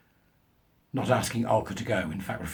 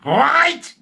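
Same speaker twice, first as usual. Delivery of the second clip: put-on much higher, louder, slow German accent; mock German professor; 10 dB louder than usual. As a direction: ham up a self-important German scientist. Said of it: "of German extraction".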